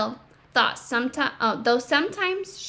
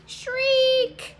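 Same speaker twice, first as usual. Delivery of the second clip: acted shriek; 13 semitones higher, 4 dB louder than usual. Reading about Temple's tantrum.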